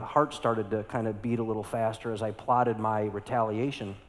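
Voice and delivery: usual speaking voice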